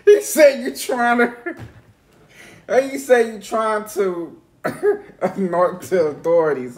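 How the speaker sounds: helpless laughter breaking up the quote; cracking up. Real, not acted. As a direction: try to repeat the line through real laughter. Cracking up retelling the clip's line.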